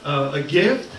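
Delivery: normal talking voice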